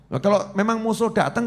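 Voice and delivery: usual speaking voice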